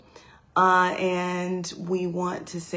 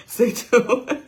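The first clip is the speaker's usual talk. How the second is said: louder, higher, laughing through it; slow, amused sign-off broken by laughter; 6 semitones higher, 5 dB louder than usual. Real, not acted. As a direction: chuckle while you say it. Laughing at her own poem.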